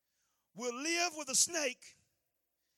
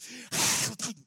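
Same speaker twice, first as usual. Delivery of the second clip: sinister snake laugh; 5 dB louder than usual. Acted. The snake laughs before biting.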